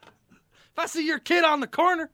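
high, loud shouting coach rant; Mike Gundy rant. Retold or imitated. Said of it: "Oh, Mike Gundy".

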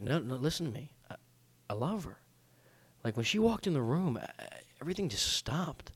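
soft, halting, mock-earnest plea; feigned lovesick sincerity. Convincing the doubting girls he's in love, feigned sincerity. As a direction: plead softly, pretending to be smitten. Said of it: "I'm a decent actor".

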